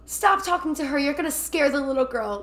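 mock stern yell. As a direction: bark it at him as the creepy old man. Creepy old man warns Larry.

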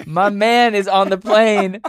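high, slow, stretched-out words; teasing mock-impressed. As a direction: draw it out high, teasing him. Acting out reaction to friend's post.

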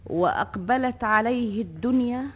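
normal talking voice

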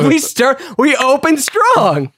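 high, drawn-out insistence; drunken bar argument. Acted. Co-host argues strategy at an imagined bar, emphatic insistence. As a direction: hammer the point home slowly. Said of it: "we're arguing about how to approach them".

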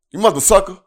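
hammed-up quote of a cussing scene; censored movie line. Quoting the TV-edited movie to his friend, hammed up.

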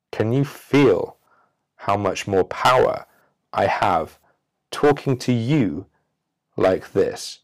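slow, measured and calm; a lightly slowed, calm demo delivery; slow, unhurried. Shown as a demonstration. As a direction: take your time with every word. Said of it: "Now compare that to me trying to rush it".

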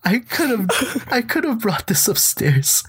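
high, drawn-out pleading squeak; mock-anguished apology. Teller apologises to the old man who came downstairs, high mock anguish. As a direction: plead high and slow, half laughing.

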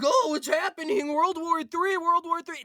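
high-pitched imitation of everyone panicking; mildly hammed-up doom hype; mock alarm. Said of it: "everyone's like".

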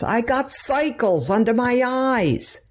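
high, slow Brooklyn whine of a cowardly lion; Cowardly Lion Brooklyn accent. Retold or imitated. Mother imitating the Cowardly Lion for her daughter, in a high Brooklyn voice. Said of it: "the Cowardly Lion, when he goes".

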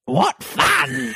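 high squawking parrot voice, lion rumble; griffin parrot voice. Acted. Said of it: "a parrot voice".

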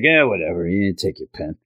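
low, softening offhand reply; distracted dismissal. Peter too distracted to look at Gwen, low offhand dismissal. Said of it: "And he's like".

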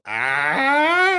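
long, high, loud "aah"; mock group jeer. Inmates after he drinks the prank soda, mocking yell. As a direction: imitate a gleeful crowd yell.

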